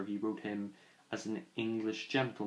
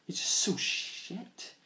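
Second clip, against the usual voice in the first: muttered curse; 8 semitones higher, slower than usual. Real, not acted. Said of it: "sorry for that".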